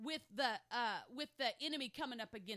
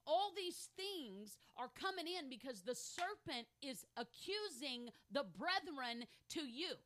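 usual speaking voice

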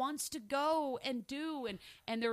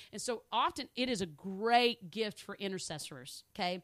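ordinary talk

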